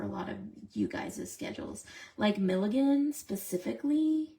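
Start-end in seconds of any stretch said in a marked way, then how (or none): none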